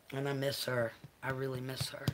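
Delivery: low, soft and subdued; quietly missing someone dear; wistful. Real, not acted. Owner recalls the dog's absent mama, wistful.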